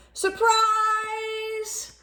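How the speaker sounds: high sung-out surprise; shouted surprise. A narrator's reading. Friends bursting in on lonely Polar Bear, sung-out shout. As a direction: sing it out like a party greeting. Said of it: "his friends shouted".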